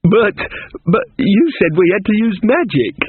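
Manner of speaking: put-on startled girl crying foul; indignant girl. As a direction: protest in surprised outrage. Amanita protests.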